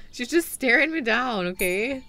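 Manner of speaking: usual speaking voice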